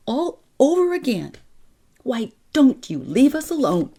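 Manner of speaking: high, near-screaming angry plea; angry outburst. A narrator's reading. Sister's mother shouting at the girl in anger. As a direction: lash out, heartbroken and furious. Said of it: "almost screaming because she was so angry".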